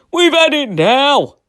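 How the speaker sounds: slow, high, loud wail of doom; despairing cry. A narrator's reading. The crew cry to each other in the storm, slow loud wail. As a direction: cry out as if all is lost. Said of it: "cried Eric's men".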